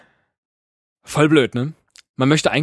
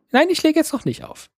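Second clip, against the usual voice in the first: high, playful lovers' phone goodbye; squeaky teasing refusal to hang up; giggly late night call. Pretend. Mock phone call goodbye game. (kichernder Telefonabschied spätnachts)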